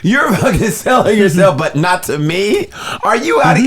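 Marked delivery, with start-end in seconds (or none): none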